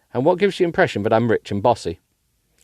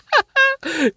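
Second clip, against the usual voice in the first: giggle. Real, not acted. Laughing again.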